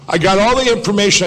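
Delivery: emphatic retold comeback; retold insistence. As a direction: push back hard and quick. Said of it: "I said".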